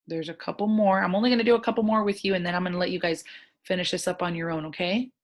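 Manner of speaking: ordinary delivery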